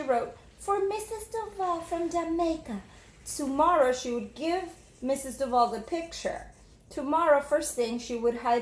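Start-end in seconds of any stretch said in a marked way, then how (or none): 0.6-2.8 put-on young girl voice. Jamaica telling her teacher, in a little girl voice. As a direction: speak up brightly as a small child. Reading girl's note.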